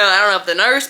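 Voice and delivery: usual speaking voice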